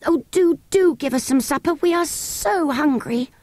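put-on high pleading child, slowing on hungry; begging child. A narrator's reading. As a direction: whine for supper. Children beg for supper.

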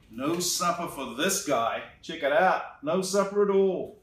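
ordinary delivery

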